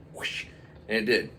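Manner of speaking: plain reading voice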